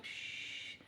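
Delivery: soft shush. Retold or imitated. Shushing toddler.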